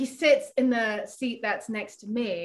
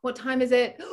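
usual speaking voice

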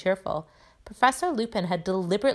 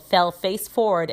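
ordinary delivery

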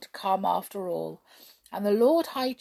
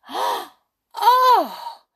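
very high, loud amazed cry; 12 dB louder than usual. A narrator's reading. Jump sees goldfish in his teacup, very high loud cry. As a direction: shriek with wonder. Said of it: "cried Jump".

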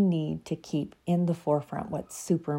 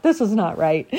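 laughing dog voice; 3 semitones higher, 6 dB louder than usual. Pretend. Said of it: "So I'm sure he's like".

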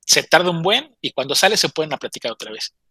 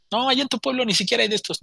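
high-pitched retold scolding; mock exasperated protest; scornful objection; 8 semitones higher than usual. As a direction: object that she knows nothing, pitch raised. Quarrel over using the phone.

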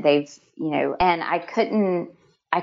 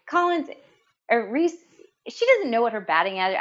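mom cutting in, higher and fast, lightly voiced; a quick amused parent's correction, pitched up; 6 semitones higher than usual. Laughing mom corrects her son in class, higher and fast. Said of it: "his mom's laughing. She's like".

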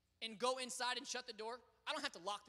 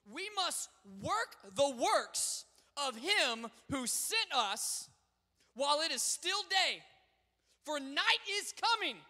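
preaching; 4 semitones higher, 9 dB louder, slower than usual. Acted. Preaching scripture at full force.

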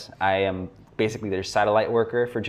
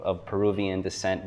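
ordinary talk

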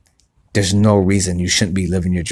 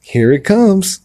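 higher, taunting mock voice; mocking Murphy's Law; 6 semitones higher than usual. Murphy's Law personified taunting him, higher mocking voice. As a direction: sneer like bad luck coming to get him. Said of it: "Murphy's Law is gonna show up".

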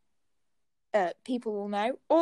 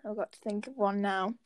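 normal talking voice

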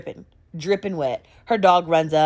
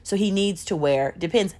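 ordinary talk, nothing marked

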